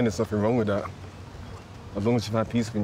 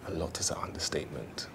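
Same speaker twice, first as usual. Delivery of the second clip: whisper; 5 semitones lower, 10 dB quieter than usual. Real, not acted. Muttered aside about slow learners.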